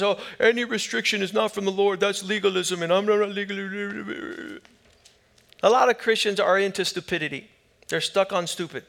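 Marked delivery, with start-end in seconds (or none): 0.0-4.6 fast mock protest trailing into gabble; mocking objector. Preacher imitating Christians who reject restrictions, mocking. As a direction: run it together dismissively, fading into nonsense.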